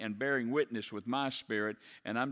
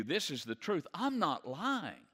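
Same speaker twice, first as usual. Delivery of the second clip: put-on higher pleading child voice on "I'm not lying"; a child pleading with a parent; 5 semitones higher than usual. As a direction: beg to be believed like a child. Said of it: "you could see the plea in his voice".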